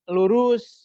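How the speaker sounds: lurus punched high and loud; emphatic word. Shown as a demonstration. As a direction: hit lurus hard and high to show emphasis. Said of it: "Ada kata-kata yang harus kita tegaskan".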